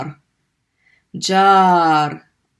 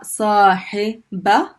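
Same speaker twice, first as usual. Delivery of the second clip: Sahiba drawn out slowly, each syllable clear; slow teaching read; 2 semitones higher, 3 dB louder, much slower than usual. Shown as a demonstration. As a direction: stretch Sahiba out for learners. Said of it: "a fatha on the ba".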